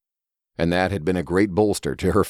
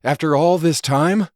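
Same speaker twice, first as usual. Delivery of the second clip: high, louder, voice cracking; voice breaking; 11 semitones higher, 4 dB louder than usual. Acted. Elder Gregory finding Judith alive, voice breaking. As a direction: let the voice crack with emotion. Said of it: "Elder Gregory's voice broke".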